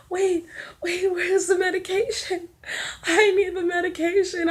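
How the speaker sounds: high-pitched, urgent mock flipping out; retold panic. Labouring mother demanding pain relief from staff, panicked. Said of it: "I was flipping out".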